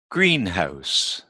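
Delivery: greenhouse with GREEN stressed; stress on first part. Shown as a demonstration. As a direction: hit GREEN in greenhouse, let house drop. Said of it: "generally the first part".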